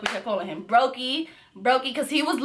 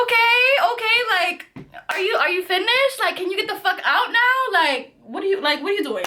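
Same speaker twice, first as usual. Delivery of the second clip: high exasperated yelling at him; 9 semitones higher than usual. Retold or imitated. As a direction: shout him down, fed up. Telling off the drunk guy.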